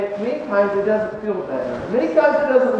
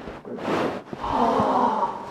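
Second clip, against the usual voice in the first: long breathy puff, acted out; demonstrated mouth-to-snout breath; rescue breath; 7 dB quieter than usual. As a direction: act out a slow breath into a tiny nose. Acts out breathing into dog's nose.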